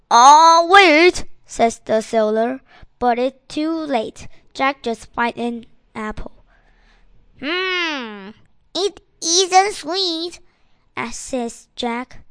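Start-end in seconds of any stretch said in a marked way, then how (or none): 0.1-1.3 loud, high-pitched alarmed call; urgent cry. Acted. Seller tries to stop Jack biting. 7.4-10.4 slow, high-pitched musing complaint; disappointed tasting. Acted. Jack tastes an apple, disappointed.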